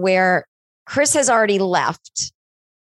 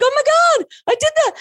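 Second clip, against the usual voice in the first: giddy excitement; 4 dB louder, much faster than usual. Retold or imitated. Writer seeing her details kept in the movie, squealing excitedly. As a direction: gush like a thrilled fan.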